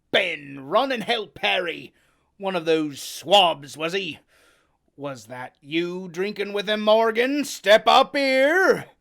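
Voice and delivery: loud, commanding sailor; Silver barking orders. Acted. Silver commands his men and Morgan, loud. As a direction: order them about.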